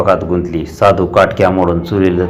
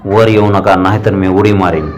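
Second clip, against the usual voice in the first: plain reading voice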